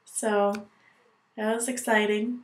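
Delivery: ordinary talk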